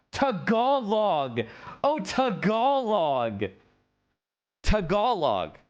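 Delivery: "Tagalog" as tuh-GAH-log, slow and drawn out; second-syllable stress. Shown as a demonstration. Streamer who just learned it, "Tagalog" as a long tuh-GAH-log. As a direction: stretch the GAH in "Tagalog", landing it with a fall. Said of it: "Now we got it".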